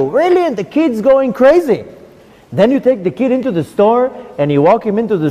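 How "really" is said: put-on excited child.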